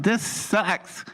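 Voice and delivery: high-pitched retold grumble; mock griping to yourself; disgusted complaint. Retold dismay at crumbled world.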